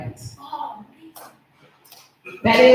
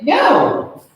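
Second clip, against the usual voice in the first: incredulous no; 7 semitones higher, 7 dB louder, slower than usual. Retold or imitated. Incredulous reply to friend's text.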